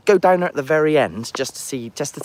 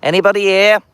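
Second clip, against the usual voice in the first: loud high call into the castle; 10 semitones higher, 10 dB louder than usual. Real, not acted. Ghost-hunting vlogger calling out loudly in the castle. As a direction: call out to whoever's hiding in there.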